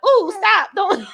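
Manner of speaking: high, loud squealing plea; mock squeal. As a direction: squeal it like something grabbed your ankle. Tiptoeing while ankles grabbed.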